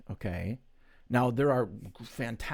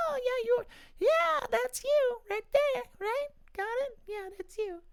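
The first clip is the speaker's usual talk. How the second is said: high falsetto character voice. Shown as a demonstration. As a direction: greet them in a squeaky falsetto character. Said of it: "sounds like I got two different characters".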